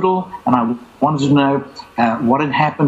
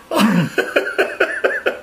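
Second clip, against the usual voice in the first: breathy laugh; 6 semitones higher than usual. Real, not acted. Laughs at unexplained note.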